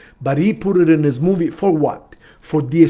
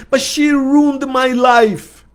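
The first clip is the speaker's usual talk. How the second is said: higher, slow imitation of her hurt complaint; retold upset woman; 8 semitones higher, 3 dB louder, slower than usual. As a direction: lift it higher and stretch it out. Woman voiced in retold session.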